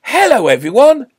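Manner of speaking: very loud, high shouted hello; big booming greeting to all; exuberant greeting. A narrator's reading. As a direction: burst out and hail the whole crowd. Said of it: "flung his door open with a bang".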